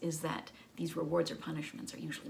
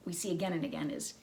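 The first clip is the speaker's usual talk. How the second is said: usual speaking voice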